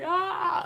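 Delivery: a sudden very high squeal of delight; mock cheer. Vlogger imagining cheering at a pet dinosaur, high squeal. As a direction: squeal like a fan on the ride. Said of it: "You guys will hear me cheering".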